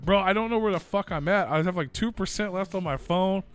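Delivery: loud, desperate mock panic; freaked-out prank caller. Stranded in Ozark woods with dying phone, freaking out. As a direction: plead loudly as if lost and drugged. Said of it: "I was just, like, freaking out".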